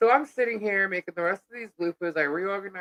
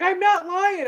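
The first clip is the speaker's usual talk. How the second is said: insisting friend; 12 semitones higher, 3 dB louder, slower than usual. Retold or imitated. Robbie insisting.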